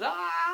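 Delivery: long high fading "aah"; mock scream of someone swept away; drawn-out high cry, trailing into distance; falling troll's wail. Troll carried down the river.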